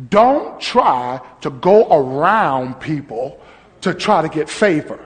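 ordinary talk, nothing marked

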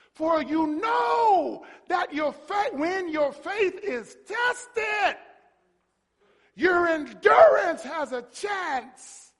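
high, loud, slowly stretched scripture shout; full-force preaching. Real, not acted. Preacher proclaiming the next verse, full-force preaching. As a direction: shout the verse, drawing out each phrase.

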